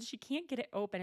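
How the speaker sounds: normal talking voice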